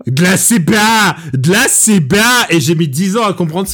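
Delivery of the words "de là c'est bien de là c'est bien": put-on slow, loud Russian-accented boast; mock Russian film-villain declaration; Drago. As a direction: imitate a heavy Russian boxer, raised and drawn out. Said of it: "en imitant Drago".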